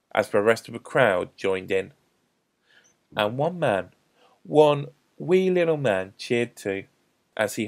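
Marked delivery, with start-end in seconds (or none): none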